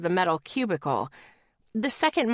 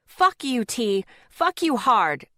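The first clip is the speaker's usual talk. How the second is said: angry retort; 4 semitones higher, 4 dB louder, slower than usual. Retold or imitated.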